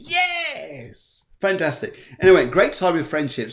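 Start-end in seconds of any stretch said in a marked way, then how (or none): 0.0-1.2 excited Virgos. Pretend. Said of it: "All you Virgos are going".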